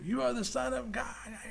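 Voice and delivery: high-pitched, mock demon cry; possessed man's cry. Preacher acting the man with a spirit facing Jesus, high cry. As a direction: imitate a demoniac crying out, high. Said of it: "the man cried out with the Spirit".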